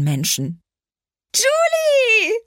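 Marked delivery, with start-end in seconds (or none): 1.3-2.3 loud, high call from behind; yelled name. Acted. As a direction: shout her name across the schoolyard. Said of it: "brüllte es hinter mir".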